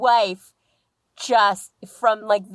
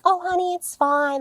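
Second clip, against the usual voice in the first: much higher, sugary people-pleaser voice; people-pleaser wife; 11 semitones higher than usual. Retold or imitated. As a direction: brush it off sweetly like nothing's wrong. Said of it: "she's that kind of person".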